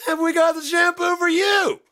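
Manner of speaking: high-pitched shouted sales pitch; hammed-up loud announcer yell; ad pitchman. Pitchman selling shampoo to customers, shouting excitedly.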